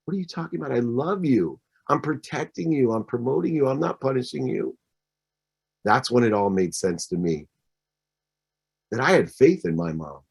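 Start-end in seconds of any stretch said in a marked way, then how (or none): 0.0-4.8 soft reassuring mother; mother softly reassuring crying child; mom's reassurance. Retold or imitated. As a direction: hush and comfort the child. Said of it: "hugged me immediately and said".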